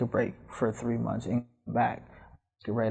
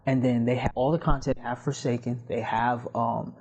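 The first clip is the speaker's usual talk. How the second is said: ordinary talk, nothing marked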